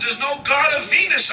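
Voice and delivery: high, loud, fed-up retort; high loud exasperation; exasperated shout. Acted. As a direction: yell it back in frustration. Guide exasperated with the Roman.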